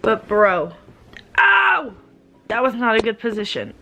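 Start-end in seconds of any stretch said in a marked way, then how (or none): 1.4-1.9 sudden loud, high yelp of pain; real jolt of pain bursting out; a pained cry as she shifts wrongly; sharp real ow. Real, not acted. Sudden pain from a bad position.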